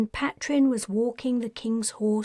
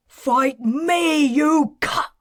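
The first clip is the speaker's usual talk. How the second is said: higher, louder screamed challenge; 5 semitones higher, 7 dB louder, slower than usual. Acted. Patrin screaming in Merlin's face. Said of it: "Her son screamed".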